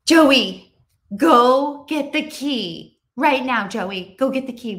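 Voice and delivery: loud, drawn-out classroom call to Joey; calling to a student. Shown as a demonstration. Teacher calling a student across the classroom, loud and slow.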